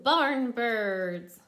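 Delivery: ordinary talk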